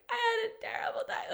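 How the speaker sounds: high, whispered sob; crying on the phone. Retold or imitated. Calling her boyfriend after a bad day, crying whisper. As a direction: choke the words out through tears. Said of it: "just crying".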